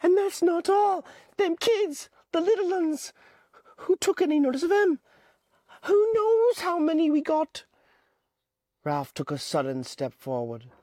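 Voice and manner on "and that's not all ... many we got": put-on high, pleading boy voice; high-pitched anxious boy Piggy; Piggy's voice. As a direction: voice the fretful schoolboy Piggy, pitched well up. Narrator voicing Piggy.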